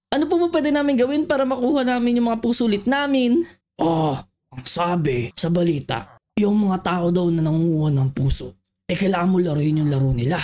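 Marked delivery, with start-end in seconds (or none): 3.7-10.4 much lower, big voice; deep villager voice. Acted. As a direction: speak as a tiny villager with a booming low voice.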